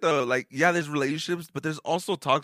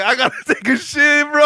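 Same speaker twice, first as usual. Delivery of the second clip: loud and laughing, higher; urgent mock yell; 12 semitones higher, 12 dB louder than usual. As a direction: blurt it out in a panic. Retold urgent need, laughing.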